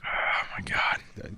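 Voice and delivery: slow whispered oh my god; whispering. Real, not acted. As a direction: breathe it out in a whisper. Whispered reaction.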